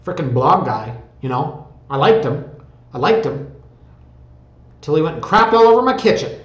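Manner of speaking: put-on southern accent. Imagined offended viewer griping about the blog guy, mock southern accent. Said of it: "I don't know why the southern accent".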